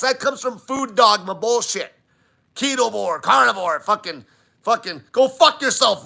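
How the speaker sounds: ordinary delivery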